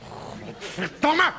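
slow, drawn-out curse bursting out; frustrated curse. Acted. Jealous man losing his self-control, drawn-out curse. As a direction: let the swear word build and burst. Jealous outburst after quiet denial.

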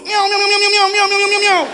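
high, loud, rapid-fire meows; frantic high-pitched kitten chorus; imitated kittens mewing. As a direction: mimic a boxful of kittens all mewing at once. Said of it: "En allemaal in die doos".

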